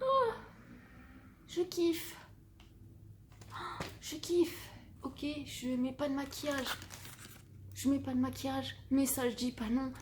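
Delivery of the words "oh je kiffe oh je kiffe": high excited gasp of joy; delighted. Real, not acted. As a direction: squeal with delight. Delighted at the gift.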